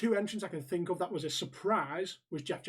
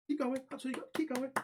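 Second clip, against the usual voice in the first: marshal cheering runners on; 7 semitones higher, 4 dB quieter than usual. Acted. Acting out marshal calling to runners.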